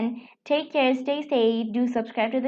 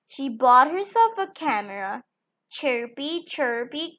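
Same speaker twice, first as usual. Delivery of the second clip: plain reading voice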